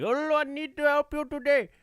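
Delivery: mock Indian accent, customer-service greeting. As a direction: play an Indian support agent opening a call. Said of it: "I can only do an Indian guy accent".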